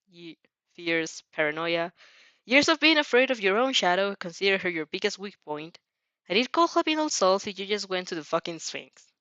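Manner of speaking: ordinary delivery, nothing marked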